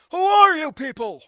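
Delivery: hammed-up exasperated yell; mock outraged shout. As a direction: bellow it like a cartoon meltdown. Meme outburst at unknown characters.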